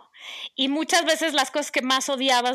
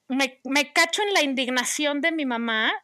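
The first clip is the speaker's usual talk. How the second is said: ordinary delivery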